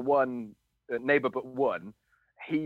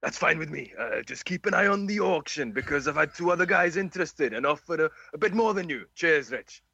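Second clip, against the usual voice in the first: lilting South Walian, pitched up; Rich, South Walian accent; 3 semitones higher than usual. Acted. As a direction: answer as the seller in put-on South Wales tones. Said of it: "do the South Walian accent".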